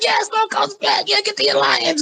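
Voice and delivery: giddy fan squeal through laughter; marking out. Retold or imitated. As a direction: cheer like a fan losing it. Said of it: "I marked the hell out".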